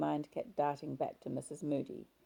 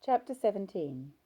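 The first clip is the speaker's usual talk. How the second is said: ordinary delivery, nothing marked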